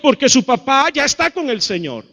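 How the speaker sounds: mock-pious loud admonishing; imitated admonishing churchgoers. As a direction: press the command on a grieving boy. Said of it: "le decían".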